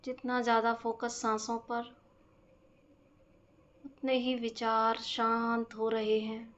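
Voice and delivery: very slow, measured meditation voice with stretched-out words and long pauses; guided breathing meditation. Acted. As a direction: guide them slowly through each breath. (धीमी, शांत ध्यान कराने वाली आवाज़)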